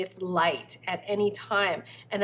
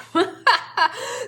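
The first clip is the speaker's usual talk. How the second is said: high, loud laugh; a sudden real laugh breaking out; burst of laughter; 11 semitones higher, 6 dB louder than usual. Real, not acted. Laughs after message.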